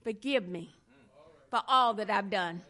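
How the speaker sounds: starts hushed and pleading, builds to loud cries of please; a prayer that swells from a whisper to a cry; sample prayer. Pretend. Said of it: "you have not said".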